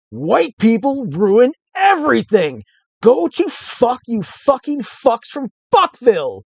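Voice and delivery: high, loud, slow cursing rant; furious shout. Acted. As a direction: spit curses at them. Hermit cursing at explorers.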